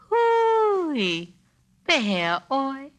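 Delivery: high, drawn-out call of Hui; Hawaiian greeting call. Shown as a demonstration. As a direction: call Hui rising high, then pehe oi. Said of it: "It was a sound rather than a word".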